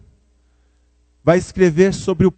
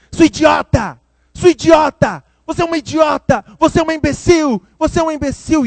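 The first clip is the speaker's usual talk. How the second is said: put-on shrill, much higher furious yell of a small boy; child yelling; 11 semitones higher, 5 dB louder than usual. A small boy in the mall screams insults at his mother. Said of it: "começou a gritar com a mãe".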